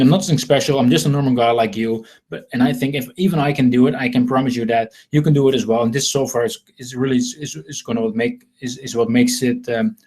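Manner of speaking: ordinary talk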